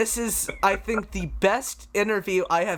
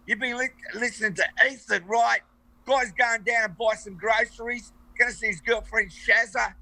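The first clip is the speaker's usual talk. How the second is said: hammed-up Australian; 3 semitones higher than usual. Guest shows how the sign-off is done, in mock Australian. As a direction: ham up an Aussie bloke, higher. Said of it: "now I'm gonna do a professional ending".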